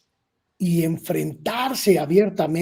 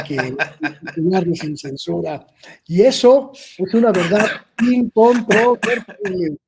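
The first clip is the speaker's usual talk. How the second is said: laughing boast; 3 semitones higher, 6 dB louder than usual. Real, not acted. Guest teasing his look-alike host about who is more handsome, laughing. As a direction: laugh while insisting on the joke.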